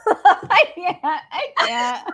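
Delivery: ordinary talk, nothing marked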